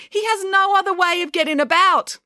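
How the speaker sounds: high-pitched, insistent protest; teacher at the end of patience; exasperated. Acted. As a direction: insist on the obvious. Teacher defends blind pupil, exasperated.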